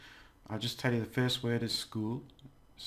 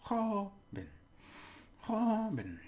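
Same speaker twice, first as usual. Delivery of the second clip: joven with a very high, drawn-out "kho"; exaggerated first-syllable stress; 11 semitones higher, much slower than usual. Shown as a demonstration. Teacher over-pronouncing for learners, long stressed first syllable. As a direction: swoop high and stretch the start of joven. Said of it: "push it up".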